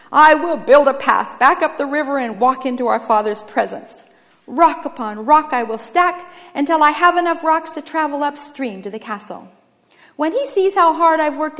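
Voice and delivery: a hint of a zealous builder; determined brother. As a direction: declare your plan with lightly theatrical resolve. Brother vows to build path.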